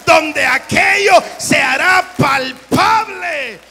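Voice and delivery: loud, high shouted exhortation; preaching at full force. Acted. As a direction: rouse the congregation with a shout. (predicando a gritos con fuerza)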